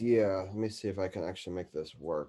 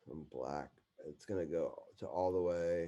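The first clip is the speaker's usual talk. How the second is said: ordinary delivery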